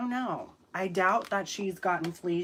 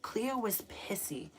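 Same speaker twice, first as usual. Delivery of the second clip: usual speaking voice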